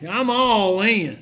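lightly voiced Brother Cox, slow and loud. Pastor repeating Brother Cox's catchphrase, slow and loud. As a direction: draw out all in like Brother Cox. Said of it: "That's how he says that".

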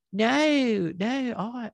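high-pitched, botched British-sounding no; accidental British accent. Retold or imitated. Host mimicking a congresswoman denying it, accidental British accent. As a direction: attempt Southern, slip into British. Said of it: "I don't know why I was gonna be British".

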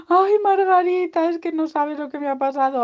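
high, loud wail to a friend; hammed-up whiny lament; sad piglet. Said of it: "que te veo muy triste".

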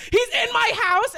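high loud incredulous question; shocked. Retold or imitated. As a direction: panic at the idea. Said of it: "And I'm like".

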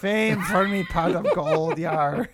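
slow Irish pirate with a laugh; Irish pirate accent. Acted. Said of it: "Every time I try to do a pirate voice".

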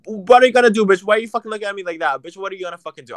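taunting her man, furious yell; retold yelling. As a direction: spit the challenge at him fast. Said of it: "and I was like".

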